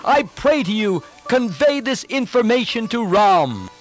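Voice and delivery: high, projected proclamation; clear, calm courage. A narrator's reading. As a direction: call out boldly and clearly from above. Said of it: "spoke out in a clear voice".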